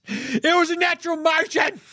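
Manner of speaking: laughing while talking. Real, not acted. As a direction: say it through a laugh. Laughing through the line.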